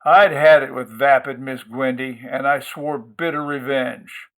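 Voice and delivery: ordinary delivery, nothing marked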